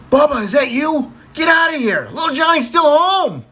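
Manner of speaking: high-pitched, urgent stage cry; gasp, then high urgent alarm. Acted. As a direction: act out a startled woman calling out. Startled wife calls to supposed lover.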